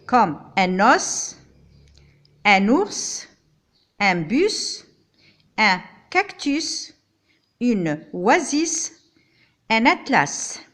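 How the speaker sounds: os, ours, bus, cactus, oasis, atlas with the final S hissed, slowly; final S heard. Shown as a demonstration. Teacher listing words whose final S is heard. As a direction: sound each final S clearly. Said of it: "Des fois, je le prononce".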